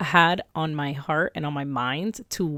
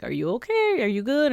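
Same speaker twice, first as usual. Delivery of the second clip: higher-pitched imitation of a checking-in husband; concerned husband; 7 semitones higher than usual. Husband checking on wife before her birthday, imitated concern. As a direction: lift it into a light, questioning pitch.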